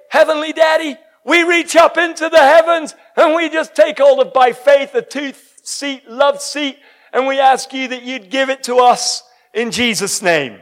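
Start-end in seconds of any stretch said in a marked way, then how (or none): 0.1-7.4 loud, high, fervent prayer; shouting a faith prayer to heaven; shouted prayer. Acted. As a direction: call out to heaven with full force. Praying aloud in a sermon.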